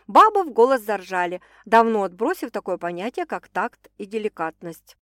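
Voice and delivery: ordinary delivery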